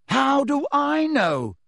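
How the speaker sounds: slow, high, loud bellow. Acted. Harry bellows at his friends in frustration. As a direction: roar the question back at them. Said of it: "bellowed Harry".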